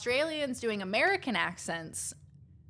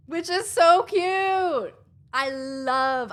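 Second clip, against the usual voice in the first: gushing cute; 6 semitones higher, 8 dB louder, much slower than usual. Real, not acted.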